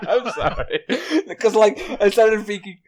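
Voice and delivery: giggling through the apology; trying to explain while still laughing. Real, not acted. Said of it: "Did you laugh at your, uh, saying bananas?".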